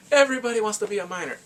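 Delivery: high, loud exclamation; amazed reveal. Real, not acted. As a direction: announce it with excited disbelief. Amazed reveal after "Guess what?.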